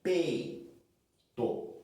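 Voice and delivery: ordinary talk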